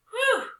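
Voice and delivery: high, loud whew of relief; relieved whew. Acted. Girl sighs in relief at her idea, high and loud.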